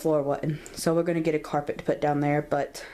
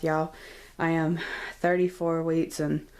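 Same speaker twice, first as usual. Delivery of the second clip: ordinary talk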